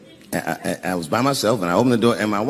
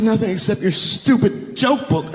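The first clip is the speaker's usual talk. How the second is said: high-pitched, exasperated put-on voice; scornful wife; 10 semitones higher than usual. Wife telling husband what the box held, scornful. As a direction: sneer it as the fed-up wife. Angry wife belittles the joke book.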